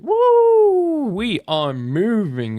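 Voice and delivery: high, drawn-out joyful woo; excited whoop. Real, not acted. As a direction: cheer as the profits jump. Trade profits jump live.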